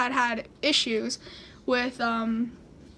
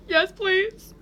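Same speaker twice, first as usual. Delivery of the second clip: higher, small please; pleading; 5 semitones higher than usual. Retold or imitated. Frightened teen asking operator to stay on, pleading.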